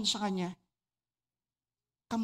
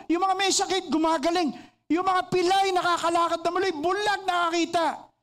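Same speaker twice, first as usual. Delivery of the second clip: fervent preaching; 6 semitones higher than usual. Acted. Preacher to congregation about apostles, fiery preaching. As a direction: build the miracles to a fiery climax.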